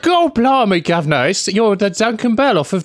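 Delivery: high-pitched, over-the-top cabbie caricature; hammed-up "blimey, governor" cabbie; taxi driver impression. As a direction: gush like a star-struck mock taxi driver. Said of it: "taxi driver. That's how they all talk".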